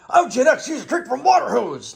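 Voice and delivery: lightly voiced parody of a Gen Xer; mock Gen X boast. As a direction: toss off a mock tough-guy brag. Mocks a Gen X stereotype.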